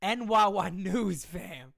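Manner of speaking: usual speaking voice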